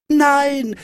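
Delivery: very high defiant cry; high-pitched childish protest; shouted refusal. A narrator's reading. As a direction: yell it like a stubborn little boy. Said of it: "schrie Häwelmann".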